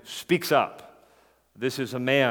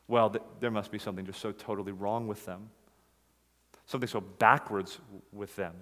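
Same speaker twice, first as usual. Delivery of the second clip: judgmental scorn; 5 semitones lower, 6 dB quieter than usual. Retold or imitated. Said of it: "the sort of attitude".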